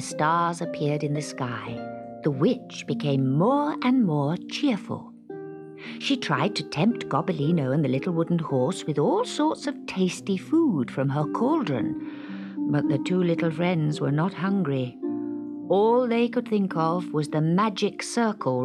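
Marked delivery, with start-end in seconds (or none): none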